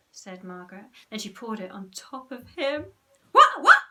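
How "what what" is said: high, loud sputtering shock; Henry drenched with fizzy drink, sputtering. Acted. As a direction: splutter it out while choking. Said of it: "sputtered Henry".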